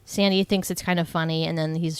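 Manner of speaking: usual speaking voice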